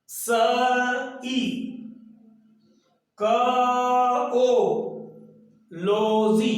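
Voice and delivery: each letter of स ई क ओ लो जी said separately with pauses; spelled out letter by letter. Shown as a demonstration. Teacher spelling the word out slowly for students. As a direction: sound out स, ई, क, ओ, लो, जी one by one for the class. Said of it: "पहले ऐसे बोलेंगे".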